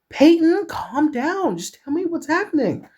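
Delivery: high-pitched character voice trying to soothe; starts loud and high, then eases; soothing friend. Acted. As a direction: urge calm in a very high voice. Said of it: "she said soothingly".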